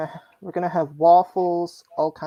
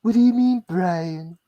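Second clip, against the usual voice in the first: Stewie Griffin impression; 2 semitones higher than usual. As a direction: play a haughty British cartoon baby. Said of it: "I almost pulled off a Stewie Griffin".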